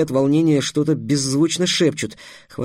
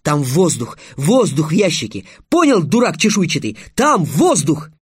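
high, raised-voice insistence; building, pitched-up shout; yelling; 4 semitones higher, 5 dB louder than usual. A narrator's reading. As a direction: yell it with rising exasperation. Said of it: "завопил Трикс".

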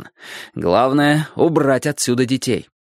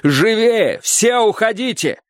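high, drawn-out call to hurry; urgent cry; 10 semitones higher, slower than usual. A narrator's reading. Alli hurrying children off the playground, urgent cry. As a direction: call out urgently, raising the pitch. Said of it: "закричала Алли".

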